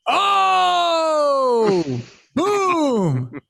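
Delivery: high, long drawn-out "oh" then boom; mock big reaction. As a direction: celebrate the zinger, stretched out high. Hamming reaction to a joke landing.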